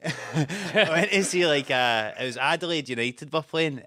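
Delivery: ordinary delivery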